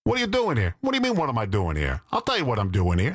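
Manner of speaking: brash New Yorker impression, jumping up in pitch then dropping; New York accent. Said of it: "You telling me New York is sexy?".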